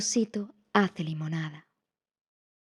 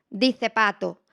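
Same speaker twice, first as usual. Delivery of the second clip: ordinary delivery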